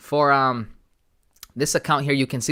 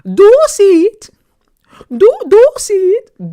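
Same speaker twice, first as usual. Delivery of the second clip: much higher, louder and slow mock teacher; teacher asking; 16 dB louder, slower than usual. Teacher asking class, high loud mock accent. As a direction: drag it out like the teacher. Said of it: "she'll say".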